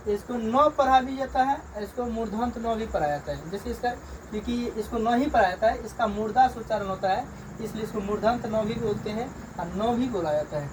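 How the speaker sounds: ordinary talk, nothing marked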